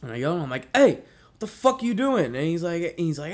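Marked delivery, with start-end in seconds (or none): 0.7-2.3 high, loud challenge; acting out an angry yell; confrontational yell. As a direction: shout at an intruder caught at the lock. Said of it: "this yelling match".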